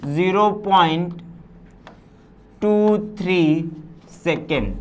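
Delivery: Zero point टू three drawn out, each word apart; slow dictation. Shown as a demonstration. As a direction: dictate it slowly as you write. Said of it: "मैंने कहा". (बहुत धीमे, शब्द-शब्द अलग)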